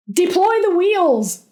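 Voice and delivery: loud, high panicked yell; desperate loud yell. A narrator's reading. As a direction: shout the orders in a panic. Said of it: "he yelled".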